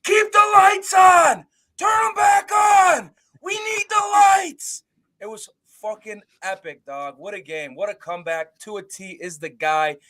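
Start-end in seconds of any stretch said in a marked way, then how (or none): 0.0-4.8 slow, high, full-throated yell; drawn-out cheering shout from the seats; stadium shout. Retold or imitated. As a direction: bellow it from the stands. Standing on chair cheering.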